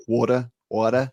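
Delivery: Brit trying an American "water"; attempted American accent. Shown as a demonstration. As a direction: say WATER American-style, not quite convincingly. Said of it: "can't even do it in an American accent".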